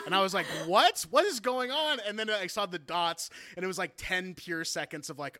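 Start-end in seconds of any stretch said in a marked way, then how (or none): 0.6-2.0 mock shock. Retelling shocked text reaction.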